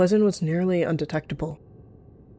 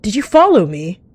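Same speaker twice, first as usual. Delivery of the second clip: loud, higher, indignant question; angry challenge; 5 semitones higher, 11 dB louder than usual. A narrator's reading. Cat to the one trailing her, angrily confronting. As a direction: confront them, voice jumping up. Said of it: "almost immediately baring her teeth".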